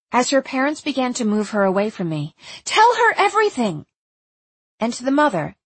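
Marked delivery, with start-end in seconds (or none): 2.6-3.9 high, loud urgent call. A narrator's reading. Narrator calls after Erzsébet as parents move her away, urgent. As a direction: call it out, pitched right up. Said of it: "I said urgently to Erzsébet".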